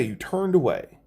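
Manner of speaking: usual speaking voice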